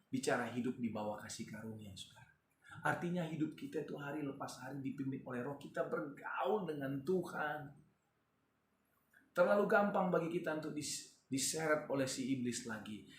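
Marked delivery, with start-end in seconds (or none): none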